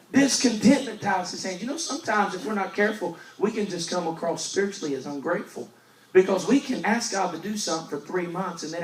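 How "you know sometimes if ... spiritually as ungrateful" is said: usual speaking voice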